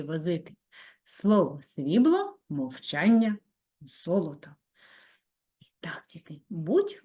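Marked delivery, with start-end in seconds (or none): 5.6-6.4 whisper. Acted. Sudden whisper to class.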